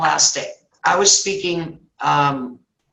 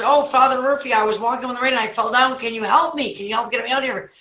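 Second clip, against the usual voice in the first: lightly acted high-pitched appeal for help; trapped man pleading; 6 semitones higher than usual. Trapped man begs the priest for help, lightly acted plea. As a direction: plead upward as the stranded man. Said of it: "And he says".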